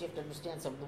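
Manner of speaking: ordinary talk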